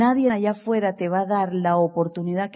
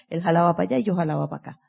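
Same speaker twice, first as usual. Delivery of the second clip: the same line rattled off very fast; rapid repeat; 2 semitones lower, much faster than usual. Acted. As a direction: rush it out in one breath. Repite la frase a toda prisa. (repetido a toda velocidad)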